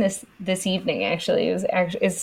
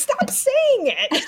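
high, slow little-kid imitation; toddler voice; much slower than usual. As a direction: mimic a small child telling mom off. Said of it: "He goes".

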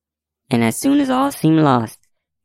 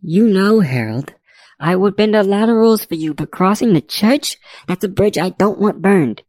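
firm woman judge; 8 semitones higher than usual. A narrator's reading. Judge refusing to cross the church, firm. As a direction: lay down the limit in a raised voice.

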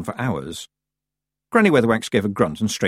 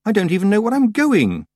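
high, loud wail of objection; exasperated protest; 12 semitones higher, 5 dB louder than usual. A narrator's reading. Tiffany protests to the Feegles, exasperated. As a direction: climb high in helpless exasperation.

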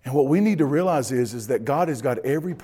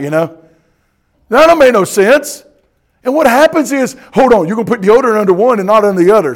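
loud, raised preacher's delivery; full-force preaching; 7 semitones higher, 11 dB louder than usual. Acted. Pastor expounding scripture to his congregation, preaching loudly. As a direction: project it hard to the congregation.

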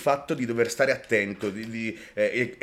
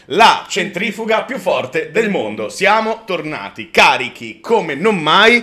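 loud, slow, high show intro; announcer voice; 5 semitones higher, 9 dB louder, slower than usual. Acted. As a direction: hype up the show opening.

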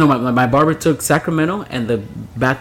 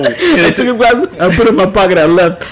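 higher, louder, laughing all through; laughing retelling; 7 semitones higher, 6 dB louder than usual. Retold or imitated. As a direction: crack up while quoting the barber. Laughing, quoting the drunk barber.

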